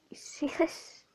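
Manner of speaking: high hushed whisper. Real, not acted. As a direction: whisper it, amazed. Showing something on screen.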